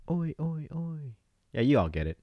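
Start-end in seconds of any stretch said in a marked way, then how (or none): 0.1-1.2 high but soft answering chant; distant crowd response. Pretend. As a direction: answer the cheer like a far-off crowd. Answers own Aussie chant as crowd.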